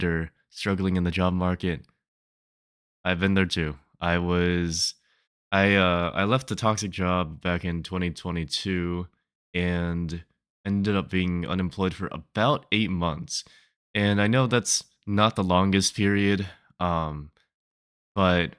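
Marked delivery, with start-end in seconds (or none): none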